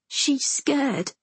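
put-on high, little child's voice; timid young brother; small boy. A narrator's reading. Frightened young brother answers.

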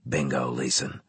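low, quiet officer voice; grave commander. A narrator's reading. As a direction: confirm bad news in a low tone. Commander confirms the adjunct's worry.

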